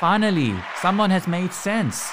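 loud, sarcastic exclamation of praise; mock celebratory shout; excited cheer. Real, not acted. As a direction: cheer him like a crowd, tongue in cheek.

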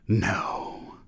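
drawn-out, voiceless no; weak refusal. A narrator's reading. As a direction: breathe out a faint, desperate no. Perry's last, desperate refusal.

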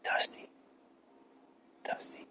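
whispered calling of a name, voiceless; eerie whisper. Retold or imitated. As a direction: breathe the name out like a spooky mother. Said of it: "this is what she'd say to me".

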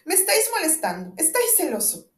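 high, loud irritated retort; annoyed outburst. Acted. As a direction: stamp and scold him. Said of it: "golpeando con los pies".

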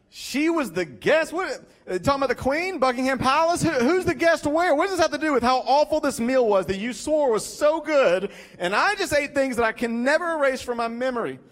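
high-pitched, incredulous rant; baffled indignation. Retold or imitated. Baffled diner challenging his friend over an awful meal, indignant.

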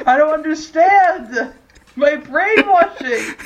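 confused Bucky. Retold or imitated. Mocking Bucky who cannot solve logic puzzles, slow, high mock wail. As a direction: wail like a lost brainwashed soldier. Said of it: "He just stares at us like".

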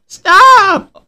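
put-on high cooing baby voice. Shown as a demonstration. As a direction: pout it out like a toddler. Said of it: "I wish I could do it".

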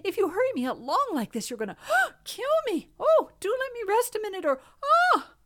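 high, gasping between phrases; breathless pleading. A narrator's reading. Medio Pollito whirled by the wind, breathless pleading. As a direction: plead out of breath, breaking off in a gasp. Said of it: "he was so breathless".

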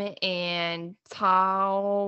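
MOM and TOWEL with long stretched vowels; elongated vowels. Shown as a demonstration. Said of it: "For my elongated vowels".

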